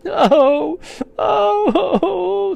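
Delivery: loud, high theatrical wails; sobbing. A narrator's reading. The fawn cannot stop crying, sobbing. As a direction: wail in hammed-up sobs. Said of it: "sobbed Mister Tumnus".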